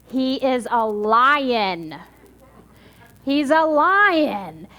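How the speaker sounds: LION stretched out slowly; very slow, weighty stress on "lion"; dramatic revelation. A narrator's reading. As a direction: draw out "lion" as a big reveal. Story's revelation, repeated for effect.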